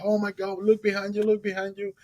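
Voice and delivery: acted high-pitched amazement; astonished. Boat owner spots dolphin behind swimmer, astonished. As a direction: call out in urgent wonder, pitch way up.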